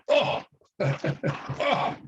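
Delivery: demonstrated "oof" of a gut punch, then laughing; high, winded "aw" as if punched; punched-in-stomach grunt. Shown as a demonstration. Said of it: "someone were to punch me in the stomach".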